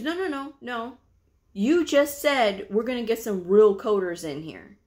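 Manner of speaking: high quick "no"s, then pointed quote; indignant challenge. Retold or imitated. Partner to the backpedaling provider, indignant challenge. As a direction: refuse to let it slide, acted out.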